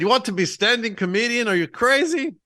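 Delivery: mock Greek dad voice; Greek accent. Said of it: "my dad has a thick Greek accent".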